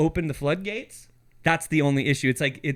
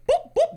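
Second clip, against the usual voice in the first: silly high boop noises; 4 dB louder than usual. Retold or imitated. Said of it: "they're like".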